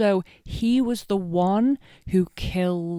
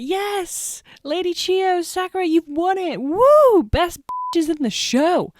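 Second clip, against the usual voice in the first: excited high cheer with a loud whoop; 11 semitones higher, 5 dB louder than usual. Retold or imitated. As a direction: cheer them on like a thrilled fan. Cheering the heroines' win.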